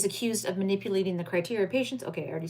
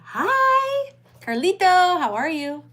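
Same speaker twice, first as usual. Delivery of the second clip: excited greeting; 9 semitones higher, 11 dB louder, slower than usual. Real, not acted. Streamer greeting a chatter by name.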